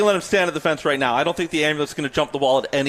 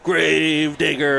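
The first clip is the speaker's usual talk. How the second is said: very slow, drawn-out announcer name call; monster truck ad; much slower than usual. Retold or imitated. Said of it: "when they used to come to Skydome".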